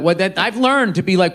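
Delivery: ordinary delivery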